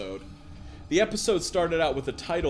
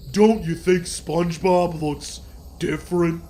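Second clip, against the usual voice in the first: loud, higher cartoon character voice; Patrick; 5 semitones higher, 9 dB louder than usual. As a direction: ask it in a big, put-on cartoon voice. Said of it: "Patrick began".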